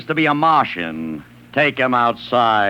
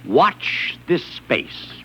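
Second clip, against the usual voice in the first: slow ad read; 3 dB quieter, much slower than usual. Retold or imitated. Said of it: "place ads in the paper saying".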